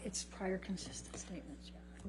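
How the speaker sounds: hushed whisper to the judge. Real, not acted. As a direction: whisper it under your breath. Whispered sidebar at the bench.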